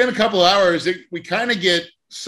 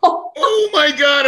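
loud, high, slow gasp of the whole class; a drawn out, shocked "Oh my God" up high; 12 semitones higher, 5 dB louder, much slower than usual. Retold or imitated. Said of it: "Everybody in the class was like".

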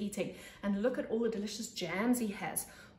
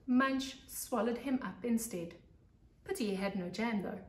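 put-on lively read-aloud voice for young kids; storybook narration; 2 semitones higher, 5 dB louder than usual. A narrator's reading. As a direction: tell it like a teacher to her class.

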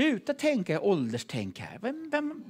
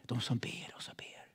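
whisper; 5 semitones lower, 11 dB quieter than usual. Shown as a demonstration. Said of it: "repeated phrase fading to whisper".

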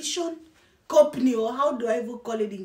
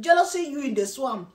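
normal talking voice

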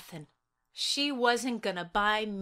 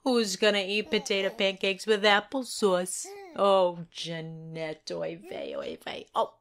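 put-on Yiddish accent; 3 semitones lower than usual.

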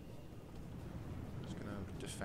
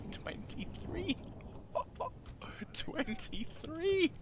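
giggling; 13 semitones higher, 6 dB quieter, much slower than usual. Real, not acted. Laughing at the number.

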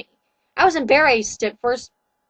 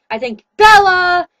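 a louder, curt retort; snapped; 7 dB louder than usual. Acted. Girl snapping jealously at Edward about Bella, louder curt retort. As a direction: bite the line back at him, sharply. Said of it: "I snapped".

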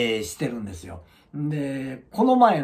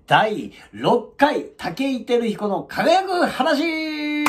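slow, drawn-out, high title announcement; segment title call; 10 semitones higher, 3 dB louder, much slower than usual. Acted. Host announcing today's segment title slowly and high. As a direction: stretch out the segment name grandly.

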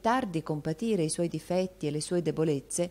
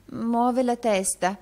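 high, slow, louder absent-minded repeat; drawn-out, distracted echo in a raised voice; 8 semitones higher, 6 dB louder, slower than usual. A narrator's reading. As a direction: repeat it as if your mind is elsewhere. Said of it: "ripeté la signorina Squeers con l'aria distratta".

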